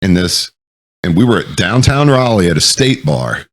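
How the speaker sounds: ordinary talk, nothing marked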